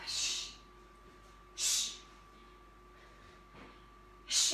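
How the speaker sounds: unvoiced shh breaths out; sharp shushing exhale. Real, not acted. Instructor breathing with back exercise, shushing exhales.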